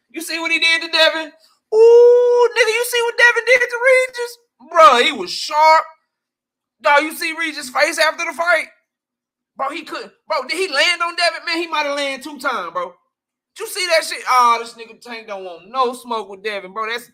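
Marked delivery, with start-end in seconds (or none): 0.1-8.7 high, loud excited fan yelling about the fight; hyped fan shouting. Retold or imitated. Fan to his friends after the fight, high excited yelling. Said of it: "make a motherfucker be like". 9.6-16.3 high-pitched hyped fan recap; excited fan. Retold or imitated. Fans arguing after the fight, high excited chatter. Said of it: "make a motherfucker be like".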